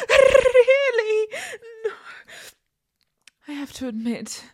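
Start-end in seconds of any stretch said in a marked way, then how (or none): 0.1-1.3 a much louder, laughing "Really?"; laughing shout. Acted. Catgirl stuck in a window, laughing loudly at her partner. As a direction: laugh it out loud in disbelief.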